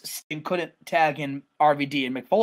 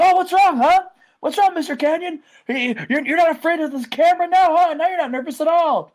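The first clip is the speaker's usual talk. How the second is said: high, loud gloating taunt; mock the man you're hurting; Jericho; 11 semitones higher, 5 dB louder than usual. Wrestler torments rival's dad on camera, gloating. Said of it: "He's, like".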